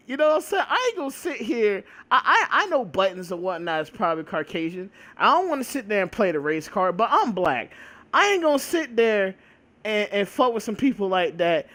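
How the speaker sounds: ordinary talk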